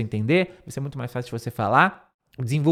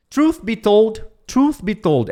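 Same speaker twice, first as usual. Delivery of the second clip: high model phrase; 6 semitones higher, 4 dB louder, slower than usual. Shown as a demonstration. Teacher models English phrase.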